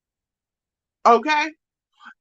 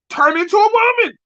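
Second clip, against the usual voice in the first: 1 mocking taunt; 11 semitones higher, 4 dB louder, much faster than usual.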